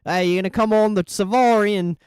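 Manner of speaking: a cartoon Aussie inviting you on safari, Australian accent throughout. Chat asks for the Australian voice, rusty hammed-up Australian accent. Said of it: "Sell me the Australian".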